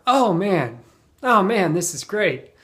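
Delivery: thrilled "oh man"; excited. Shown as a demonstration. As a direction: say it like great news just landed. Example of happy use.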